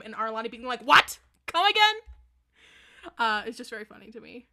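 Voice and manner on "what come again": loud, high-pitched mock shock; shocked disbelief. Arlani hearing they shared a room, shocked. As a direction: burst out incredulous, drawing out the last words.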